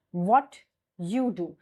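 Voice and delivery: WHAT YOU DO slowly, T kept apart from Y; slow, separated "What you do"; unassimilated demo. Shown as a demonstration. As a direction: pause after "What", then "you do". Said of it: "Like if I say".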